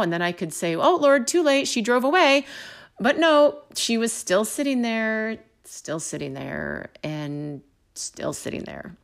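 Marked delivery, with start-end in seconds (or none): 0.7-2.4 higher-pitched pretend regret; mock excuse. Imagined excuse to God. 5.6-9.0 slow, low and softer; dragging repetition. Retold or imitated. Drawn-out waiting, repeated for effect.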